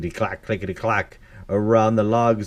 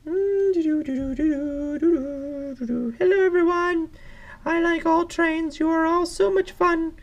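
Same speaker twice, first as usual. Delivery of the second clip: high-pitched character voice; judge addressing crowd. Acted. Judge announcing favorite at train show, high pleased voice. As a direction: greet everyone in a high, squeaky judge voice. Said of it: "here comes the judge".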